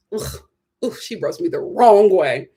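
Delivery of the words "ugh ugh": ordinary talk, nothing marked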